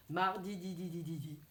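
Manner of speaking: MARDIDI trailing into an evenly chanted DI, DI, DI, DI; repeated final syllable. Shown as a demonstration. Teacher showing the final syllable, DI repeated rhythmically. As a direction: echo the DI of MARDIDI over and over in steady beats. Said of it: "On dit plusieurs fois la syllabe finale".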